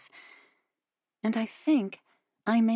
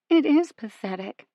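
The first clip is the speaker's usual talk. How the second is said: holding back a sob; 6 semitones higher, 5 dB louder than usual. A narrator's reading. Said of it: "trying to hold back another sob".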